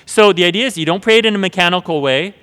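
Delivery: ordinary delivery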